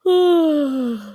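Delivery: a long, sighing yawn; the mother yawning at bedtime; tired yawn. A narrator's reading. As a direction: yawn out loud, bored. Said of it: "My mom yawns".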